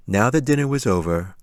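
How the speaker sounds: ordinary delivery, nothing marked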